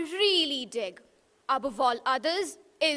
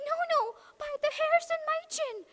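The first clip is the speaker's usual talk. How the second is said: much higher, fast little pig voice; frightened little pig; 12 semitones higher, much faster than usual. Acted. Little pig refusing the wolf, high and fast. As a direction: squeal out the refusal, quick and high.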